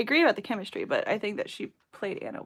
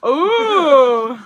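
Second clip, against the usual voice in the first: mock swoon; 5 semitones higher, 17 dB louder than usual. Teasing about Fifty Shades.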